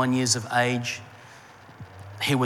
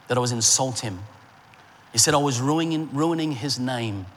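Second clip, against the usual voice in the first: usual speaking voice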